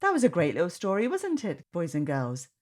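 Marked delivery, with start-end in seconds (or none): none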